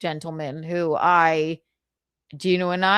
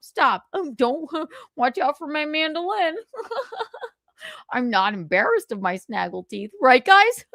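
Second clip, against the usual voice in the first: Carl impression; 7 semitones higher than usual. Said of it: "Here's my Carl impression".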